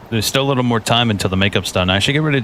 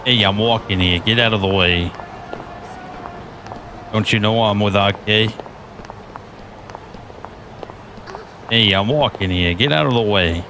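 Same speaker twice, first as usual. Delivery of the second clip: put-on New York accent; 3 dB quieter than usual. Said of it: "like I have a New York accent".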